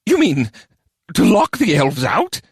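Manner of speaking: high goblin squeak with a wicked grin; wickedly gleeful. A narrator's reading. Goblin grasping the mayor's plan, wickedly gleeful. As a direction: relish it slyly as a little goblin. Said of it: "said with a wicked grin".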